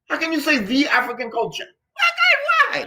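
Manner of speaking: very high exclaimed question; incredulous challenge. Real, not acted. Teacher challenging talk of one African culture, incredulous. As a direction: throw the question up high in disbelief.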